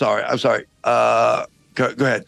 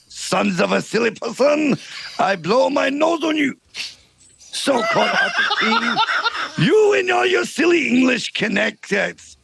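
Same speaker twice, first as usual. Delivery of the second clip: put-on French accent; 3 semitones higher than usual. French guard taunting King Arthur's knights, hammed-up French accent. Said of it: "this outrageous accent".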